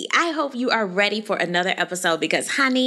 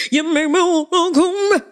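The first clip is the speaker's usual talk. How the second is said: sassy threat; 8 semitones higher, 4 dB louder than usual. Pretend. Mock threat to Candace.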